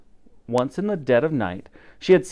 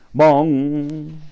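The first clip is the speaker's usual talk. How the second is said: louder, voiced bell toll; clock bong; 3 dB louder than usual. A narrator's reading. Narrator voicing Big Ben striking, a loud booming bong.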